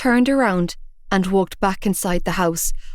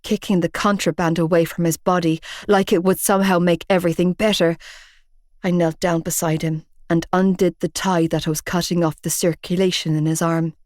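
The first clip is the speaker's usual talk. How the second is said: usual speaking voice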